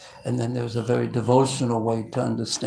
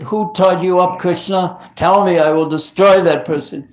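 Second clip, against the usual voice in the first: much louder, higher enraged demand; furious shout; 6 semitones higher, 10 dB louder than usual. Acted. Balaram demanding who tied Krishna, enraged shouting. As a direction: bellow it, ready to fight anyone. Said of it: "He was so angry".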